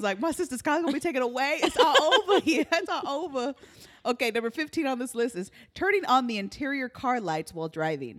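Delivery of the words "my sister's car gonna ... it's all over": mock despair, higher and half laughing; freaking out. Driver retelling fear for her sister's car, laughing mock despair. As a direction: wail lightly that all is lost, amused. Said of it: "I was freaking out".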